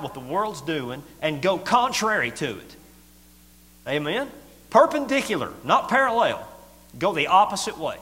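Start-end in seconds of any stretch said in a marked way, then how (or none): 3.9-8.0 high, loud, ringing exhortation; preaching shout. Real, not acted. Preacher urging the church to go against the world, shouting high. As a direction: drive it home to the crowd.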